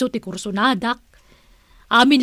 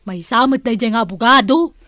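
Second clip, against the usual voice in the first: usual speaking voice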